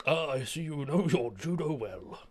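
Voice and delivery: grandly theatrical impression of a performer; very dramatic fellow. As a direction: savour it like a hammy stage actor. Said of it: "He was a very dramatic fellow".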